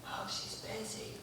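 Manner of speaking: hushed, secretive little voice; child's whisper. Acted. Kid puts off the caller about mama, whispering.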